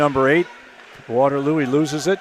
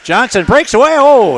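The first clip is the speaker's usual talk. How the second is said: raised, louder play-by-play burst; excited breakaway call; 8 semitones higher, 9 dB louder than usual. Real, not acted. Announcer calling Johnson's breakaway and shot, excited. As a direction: jump in as the play breaks open.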